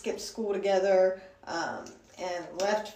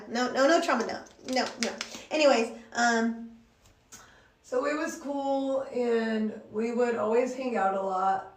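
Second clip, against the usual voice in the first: ordinary delivery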